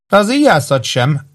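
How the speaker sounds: girl's cutting retort, mildly acted; sharp retort. A narrator's reading. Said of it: "vágott vissza a lány".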